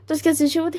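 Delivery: ordinary delivery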